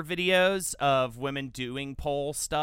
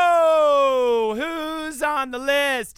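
high, loud, long-held hype call; exaggerated show-intro call; segment hype; 10 semitones higher, 8 dB louder, much slower than usual. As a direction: belt out a mock-jingle cue to the host. Performed intro to list segment.

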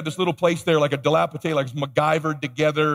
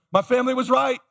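mock panic; 7 semitones higher than usual. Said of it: "my heart starts racing".

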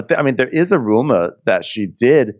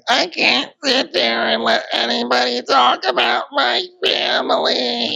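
very high, wailing fake sob, family drawn out into a cry; mock crying. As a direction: wail it like a hammed-up reality-show meltdown. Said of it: "Mia's still crying".

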